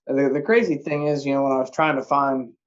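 ordinary delivery

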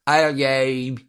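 lightly imitated Cockney accent; mock chimney-sweep Cockney, badly aimed at Australian. Host guessing how Australians say Ellume, botched Cockney. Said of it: "chimney sweep Cockney British voice".

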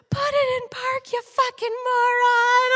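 high, loud screamed insult; acted-out enraged mother; furious mom. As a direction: scream it, stretching the final word. Mom screams at teen in car.

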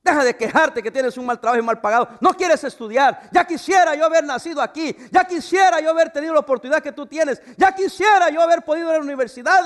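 loud, high scolding rant; hammed-up lecture to a complaining worker; retold scolding. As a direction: lay into him like a fed-up boss. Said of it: "le dije".

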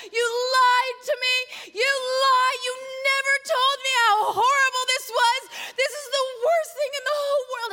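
high, loud, wailing mock tantrum; angry yelling. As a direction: reenact yelling at your mother, stretched and tearful. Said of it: "and I'm yelling at her".

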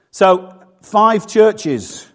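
drawn-out, loud "five churches"; slow emphatic. Real, not acted. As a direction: land "five churches" slowly and heavily.

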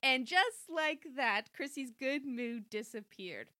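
slow, weighty narration; dramatic slow. A narrator's reading. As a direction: drag it out for drama.

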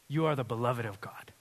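normal talking voice

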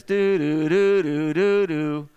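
sustained two-tone "doo-doo" siren imitation; imitated siren horn. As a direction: mimic a European police siren by mouth. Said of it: "sounds almost like the siren".

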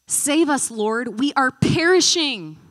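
loud, high desperate cry for help; panicked plea. Acted. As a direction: beg to be saved from drowning. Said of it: "the disciples are panicking for their lives".

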